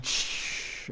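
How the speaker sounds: soft, hushed noise of breaking glass; imitated crash. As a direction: imitate glass shattering with a long "shh". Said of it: "And then we just hear".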